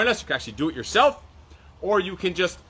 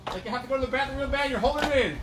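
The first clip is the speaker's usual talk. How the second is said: aerobics instructor; 7 semitones higher, 4 dB quieter than usual. Acted. Silly high cue during exercise.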